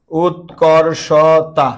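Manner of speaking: উৎকর্ষতা said very slowly as the word to avoid; wrong form, slowly. Shown as a demonstration. Teacher warning the class against উৎকর্ষতা, slow. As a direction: drag out উৎকর্ষতা to show the mistake. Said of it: "বলা যাবে না উৎকর্ষতা".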